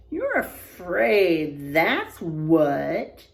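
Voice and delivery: slow, low scornful jab; taunting sister. Acted. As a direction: call him a coward.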